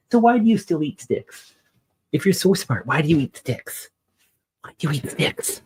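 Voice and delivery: high mock-scolding pet voice; playful pet scolding; teasing the dog. Real, not acted. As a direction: tease the dog high and sing-song.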